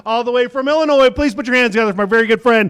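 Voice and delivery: big stage intro, rising to a shout on the name; announcer introduction. Real, not acted. Host bringing on a comedian friend, building announcer call.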